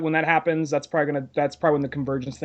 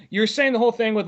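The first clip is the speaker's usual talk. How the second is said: ordinary talk, nothing marked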